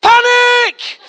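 sudden high, loud scream; mock panic shout. Mock captain alarming passengers, screamed panic. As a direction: burst out with a stretched high shout.

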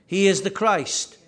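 ordinary talk

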